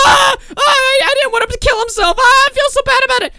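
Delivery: very high wailing crying voice; hammed-up sob of guilty kids; mock wailing panic. Chat kids wailing in mock guilt, very high.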